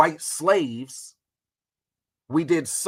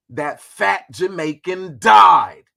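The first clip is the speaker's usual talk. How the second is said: slow and much louder, leaning on died; emphatic mocking died; 2 semitones higher, 9 dB louder, slower than usual. Shown as a demonstration. Streamer roasting Garvey, slow and loud on died. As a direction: drag it out slow and slam the ending. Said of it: "We gotta put the emphasis on that shit".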